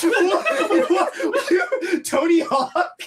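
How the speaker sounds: high-pitched, squealing through laughter; laughing hard. Real, not acted. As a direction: crack up in disbelief. Friend mixes up Hawking and Hawk.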